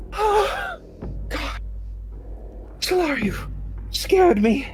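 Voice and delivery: high, breathy frightened voice; frightened gasp. Acted. Frightened NPC awakened.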